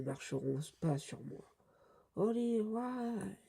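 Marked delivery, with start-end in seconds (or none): 2.2-3.4 put-on high, drawn-out attack cry in English accent; French reader hamming up English, slowly and high; mock English accent. Calling a manga attack's English name, mock English accent. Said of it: "vous pouvez voir mon super accent anglais".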